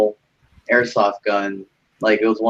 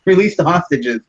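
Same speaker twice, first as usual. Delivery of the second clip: raised, higher announcing voice; mock loudspeaker negotiator; 8 semitones higher, 3 dB louder than usual. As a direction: pretend to negotiate over a loudspeaker. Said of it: "negotiating with him over loudspeaker".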